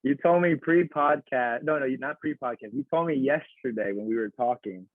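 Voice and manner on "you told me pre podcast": ordinary talk, nothing marked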